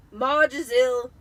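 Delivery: loud, slow gruff announcement; gruff Uncle Vernon. Acted. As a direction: report the news heavily. Vernon informs wife.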